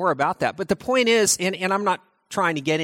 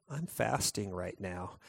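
low, soft, slow and glum; somber mope; 10 semitones lower, 14 dB quieter, slower than usual. Acted. Mourner at a wedding, low somber mumble. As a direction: mumble it like a sulking faster. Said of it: "And you're just really somber".

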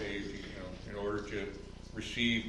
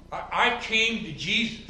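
much higher self-satisfied claim; mock boast; 10 semitones higher, 8 dB louder than usual. Pastor parodying a convert's boast, much higher. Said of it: "Haven't you heard that".

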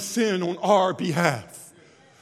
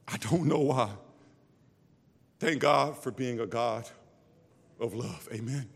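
soft, low, tender reflection; softly marvelling at God's love before the congregation; quiet wonder; 7 semitones lower, 4 dB quieter than usual. Acted. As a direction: let the voice drop in awe. Quiet prayerful aside.

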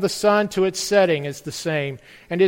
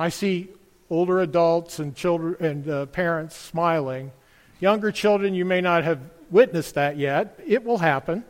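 ordinary talk, nothing marked

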